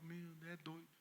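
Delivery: very soft amused aside; hushed disbelief; muttered aside. Retold or imitated. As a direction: mumble it to yourself. Pastor muttering to himself.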